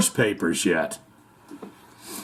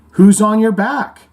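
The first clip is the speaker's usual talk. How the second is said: loud question; 7 semitones higher, 7 dB louder than usual. Retold or imitated. Joke dialogue.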